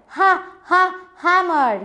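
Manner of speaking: "Ha, ha" breathy H stressed before "hammer"; h sound stressed. Shown as a demonstration. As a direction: split off the first sound "Ha" then say "hammer". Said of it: "what is the sound of the starting word".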